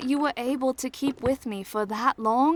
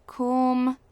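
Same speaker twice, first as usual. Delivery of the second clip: soothing; 6 semitones higher, 4 dB louder, much slower than usual. Acted. Soothing the upset boy.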